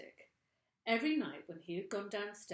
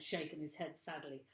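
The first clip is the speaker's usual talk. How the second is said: plain reading voice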